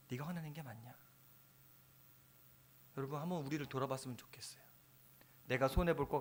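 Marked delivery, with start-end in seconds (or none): 0.1-0.9 softer, hushed repeat of the question; gentle repeated question. Retold or imitated. God asking Jonah again, softly and hushed. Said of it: "하나님은 물어봐요". 3.0-4.6 whisper. Real, not acted. Appeal to congregation.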